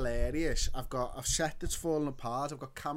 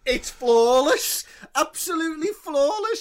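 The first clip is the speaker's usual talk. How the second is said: gushing; 12 semitones higher, 11 dB louder than usual. Real, not acted. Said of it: "perfectly fluid American fucking accent".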